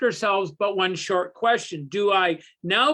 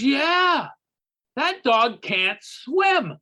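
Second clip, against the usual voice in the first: deadpan buddy punchline; 4 semitones higher, much slower than usual. Acted. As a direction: call out, then drag the verdict slowly.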